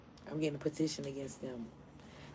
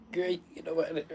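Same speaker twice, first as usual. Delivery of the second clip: ordinary delivery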